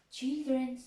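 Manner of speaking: normal talking voice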